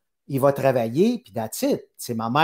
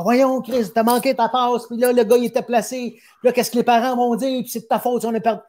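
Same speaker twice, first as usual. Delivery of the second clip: angry father; 9 semitones higher than usual. Retold or imitated. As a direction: berate the kid after the game, pitched up high. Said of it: "il me donnait de la merde dans le char".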